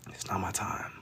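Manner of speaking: unvoiced, hushed whisper. Real, not acted. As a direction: drop to a secret whisper. Repeats in a whisper.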